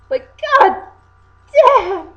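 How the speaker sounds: loud, high god damn; exasperated outburst. Real, not acted. Venting about the obnoxious voice, loud exasperated cry. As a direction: blurt it out in disbelief.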